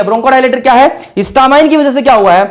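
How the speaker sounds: ordinary talk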